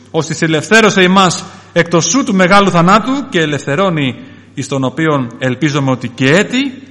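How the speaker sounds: forceful scripture reading from the pulpit; declaimed scripture. Acted. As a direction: build into a proclamation of the verse.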